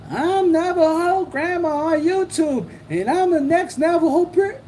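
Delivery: high, put-on mocking voice of a self-styled grandma; mock impression. Streamer mocking a YouTuber's intro, high mocking voice. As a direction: ham up a YouTuber you think is ridiculous.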